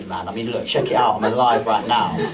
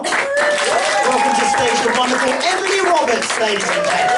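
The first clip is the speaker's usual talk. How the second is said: compere announcement; 10 semitones higher, 4 dB louder than usual. Real, not acted. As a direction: announce the act big and grand. Introducing next act.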